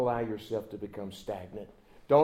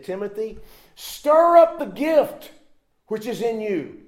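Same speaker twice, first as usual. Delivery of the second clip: much higher, louder, slow on stir up; urgent exhortation; 8 semitones higher, 8 dB louder than usual. Acted. Paul exhorting Timothy, high loud slow stir up. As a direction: rouse him with the word. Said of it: "he said".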